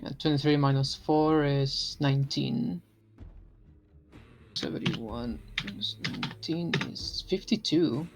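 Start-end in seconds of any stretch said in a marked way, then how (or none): none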